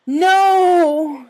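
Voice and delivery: screaming as the saw bites; agonized scream. A narrator's reading. Rainbow screams in pain, full agony. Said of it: "she screamed in pain".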